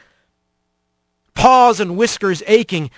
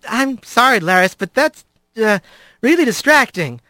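put-on higher, hesitant young Rat; awkward apology; 4 semitones higher than usual. A narrator's reading. Rat to Laris who keeps touching him, embarrassed. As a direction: stumble politely through the objection.

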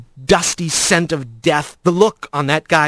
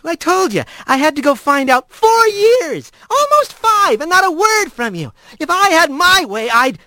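high, rising, indignant outburst; heated protest; 10 semitones higher than usual. Acted. Rat protests to Blaze, indignant and rising. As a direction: build up steam as you complain.

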